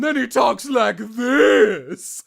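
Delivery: loud, high, elongated shout; Neza. Shown as a demonstration. Voice actor demonstrating a character's big moments, drawn-out shout. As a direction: stretch the words out on a big push of breath. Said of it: "elongating speech patterns".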